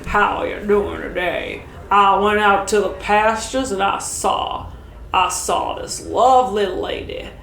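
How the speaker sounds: put-on southern gentleman accent. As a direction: drawl it out like a Southern gentleman greeting a lady. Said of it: "Please do the southern gentleman".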